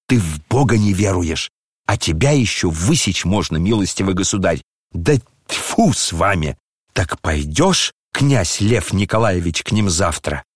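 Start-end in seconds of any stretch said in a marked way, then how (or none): none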